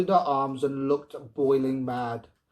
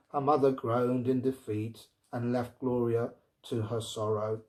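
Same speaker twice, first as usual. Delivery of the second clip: ordinary delivery, nothing marked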